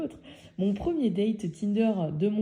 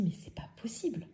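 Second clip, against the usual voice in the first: soft, slightly raised inner voice; hushed disbelief; 3 semitones higher, 8 dB quieter than usual. Retold or imitated. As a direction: mutter it in disbelief to yourself. Panicked disbelief, told to herself. (incrédule, à voix basse)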